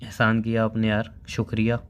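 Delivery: low, subdued sarcastic thanks. Acted. Boyfriend thanks busy girlfriend for calling, sarcastic. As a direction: thank her grudgingly, low and soft. Bitter thanks after her excuse.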